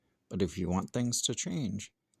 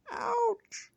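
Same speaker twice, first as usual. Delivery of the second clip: tiny mouse yelping ow in a very high squeak; mouse squeal. Acted. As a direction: squeak it out like a hurt little mouse.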